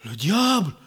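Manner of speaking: usual speaking voice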